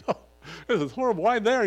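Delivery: high, loud mock exasperation; exasperated. Pastor groaning to himself at the sweat drop, exasperated. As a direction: cry out at the sweat drop in your eye.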